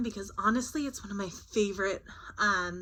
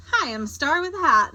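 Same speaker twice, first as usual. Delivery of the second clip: a touch higher, louder star voice; perky little star; star character; 6 semitones higher, 8 dB louder than usual. Acted. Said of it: "this one says".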